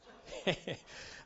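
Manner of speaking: breathy laugh at his own joke. Real, not acted. Preacher laughing at his own punchline, breathy. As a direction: let the laugh escape.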